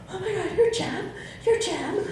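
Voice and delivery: mock tearful bride, high and squealing with a laugh; excited bride. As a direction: play the bride who can't believe it's Jem. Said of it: "almost crying".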